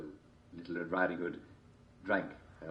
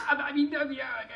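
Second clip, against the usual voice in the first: flustered wolf; 4 dB louder than usual. A narrator's reading. Wolf fumbling in story.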